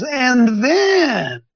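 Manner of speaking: THEN pitched high and drawn out; dramatic build. Acted. Preacher reading end-times prophecy, dramatic lift on "then". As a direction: swell on "then" before the final clause.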